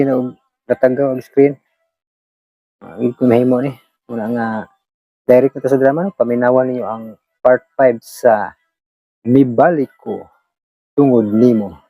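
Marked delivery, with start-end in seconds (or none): none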